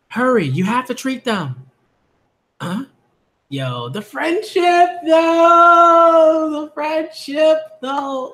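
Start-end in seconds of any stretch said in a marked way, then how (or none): 3.5-8.3 slow, high, sing-song gushing. Real, not acted. Reader gushing over the characters' friendship, slow sing-song. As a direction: savour each word, overcome.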